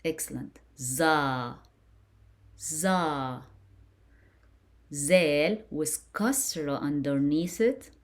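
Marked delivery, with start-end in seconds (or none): 0.8-3.4 "Za" with a held open ah; "Za" drawn out, falling at the end; long A syllable. Shown as a demonstration. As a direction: model "Za" slowly for pupils. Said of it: "I pronounce it as".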